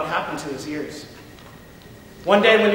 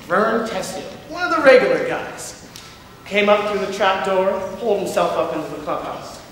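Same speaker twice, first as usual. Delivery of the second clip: usual speaking voice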